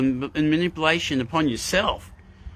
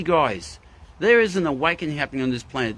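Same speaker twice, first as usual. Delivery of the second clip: ordinary delivery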